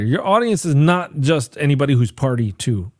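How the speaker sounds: usual speaking voice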